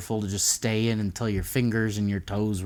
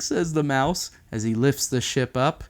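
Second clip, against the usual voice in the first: ordinary delivery, nothing marked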